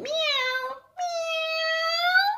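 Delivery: high squeaky meowing like a cat. Retold or imitated. Imitating cat in compound.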